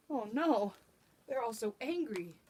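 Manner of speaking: usual speaking voice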